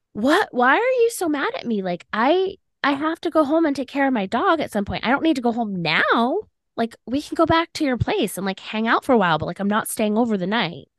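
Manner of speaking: high-pitched, incredulous pleading; retold baffled protest. Retells pleading back during the quarrel.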